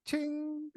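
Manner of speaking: a high, bright "ching" like a bell going off; mock ding sound. Host hearing the idea click, high mock chime. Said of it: "and I was like".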